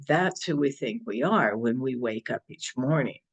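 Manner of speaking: ordinary talk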